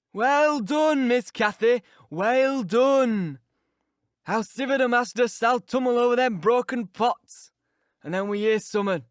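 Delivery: put-on a spiteful old servant relishing her trouble; gloating Yorkshire servant. Old servant Joseph gloating over Cathy's broken pots, loud mocking Yorkshire.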